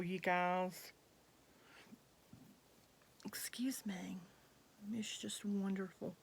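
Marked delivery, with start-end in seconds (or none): 3.3-4.2 soft breathy-free unvoiced aside, much softer; a quick whispered apology off to the side; whispered excuse me. Real, not acted. Vlogger whispers an apology to viewers while spritzing, whispered. 4.9-6.1 hushed praise. Real, not acted. Hushed praise after whispered aside.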